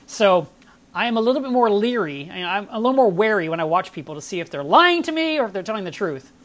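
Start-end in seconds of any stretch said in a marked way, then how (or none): none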